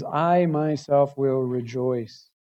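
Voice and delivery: slow, deliberate "I myself"; "I" weighted, spoken slowly; emphatic I. Shown as a demonstration. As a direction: hold each word, stress "I". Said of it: "emphatic in the Hebrew".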